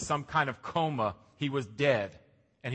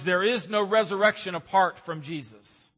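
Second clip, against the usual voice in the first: ordinary talk, nothing marked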